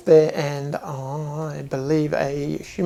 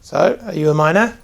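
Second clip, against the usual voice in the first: dwarf repeats question; 3 semitones higher, 7 dB louder than usual. Acted. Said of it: "He goes".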